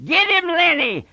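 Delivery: high, carrying command; yelling through cupped hands. A narrator's reading. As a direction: call it across the room to spur him on. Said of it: "cupped his hands around his mouth and yelled".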